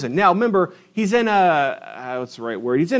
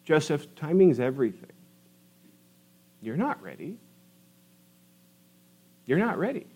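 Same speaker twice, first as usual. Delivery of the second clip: gentle reassurance; 7 dB quieter than usual. Acted. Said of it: "Way two".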